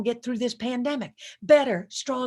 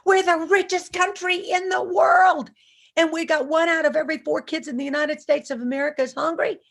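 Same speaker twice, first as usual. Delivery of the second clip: impassioned, louder and higher; 6 semitones higher, 5 dB louder than usual. Real, not acted. Speaker to the audience, loud and high with outrage. Impassioned preaching about hungry kids.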